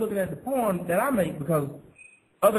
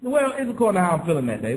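ordinary talk, nothing marked

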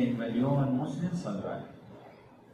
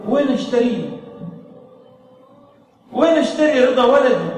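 retold anguished plea, louder and higher; imitating a weeping father; 8 semitones higher, 8 dB louder than usual. Grieving father begging the sheikh, mock weeping plea. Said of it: "وهو يصيح زيادة".

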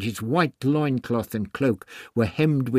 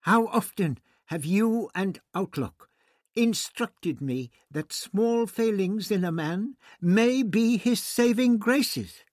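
reproachful; 10 semitones higher than usual. Acted. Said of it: "voice was reproachful".

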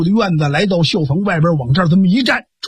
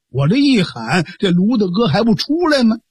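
ordinary delivery, nothing marked

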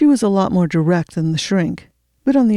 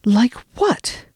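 a sudden, exasperated burst, pitch higher; angry frustration; 5 semitones higher than usual. A narrator's reading. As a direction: snap the question out in frustration. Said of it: "in a burst of angry frustration".

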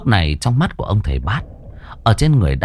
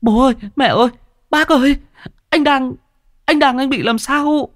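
crying out. Acted. Kiều calls parents to help her brother, high alarmed cry. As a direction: call the family in alarm. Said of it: "kêu lên".

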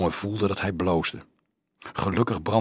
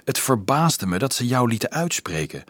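usual speaking voice

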